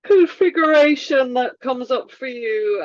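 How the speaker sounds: words stretched through a big deliberate yawn; talking mid-yawn to invite a yawn. Shown as a demonstration. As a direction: yawn wide as you say it. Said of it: "my yawn was deliberate".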